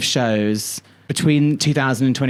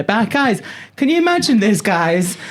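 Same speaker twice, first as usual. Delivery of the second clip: mock nostalgic exclamation; 8 semitones higher than usual. Host to listeners, mock old-timer exclaiming higher.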